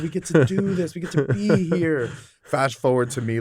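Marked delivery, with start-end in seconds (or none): 0.0-2.1 high, loud mock disbelief; hammed-up amazed outrage, voice up high and loud; mock incredulous. Background actor telling bored extras how lucky they are, in a high, loud mock disbelief. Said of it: "I'm like".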